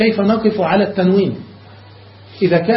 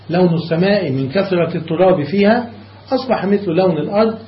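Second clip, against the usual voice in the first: ordinary talk, nothing marked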